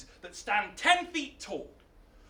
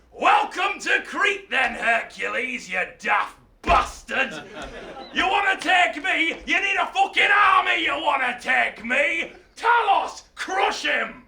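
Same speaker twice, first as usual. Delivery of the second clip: loud, high, ranting tyrant; frothing mad king; 4 semitones higher, 6 dB louder than usual. Acted. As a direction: roar it like a raving mad king. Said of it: "frothing at the mouth, saying".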